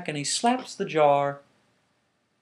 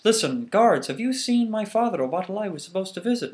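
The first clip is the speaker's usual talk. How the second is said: commanding son; 7 semitones higher, 6 dB louder than usual. Acted. Son questions the guards.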